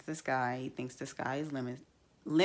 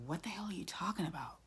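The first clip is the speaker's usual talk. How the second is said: quick, higher hissed whisper of disbelief; exasperated whisper; 4 semitones higher, 5 dB quieter, faster than usual. Real, not acted. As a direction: whisper it fast, fed up and incredulous. Exasperated at Kirk's complaint.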